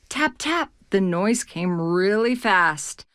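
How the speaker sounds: plain reading voice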